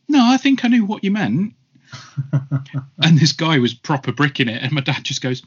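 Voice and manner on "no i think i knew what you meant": much higher, louder scared protest; cowed high voice. Retold or imitated. Driver pleading with dad, scared high voice. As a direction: whimper it out high and rattled. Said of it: "he just went".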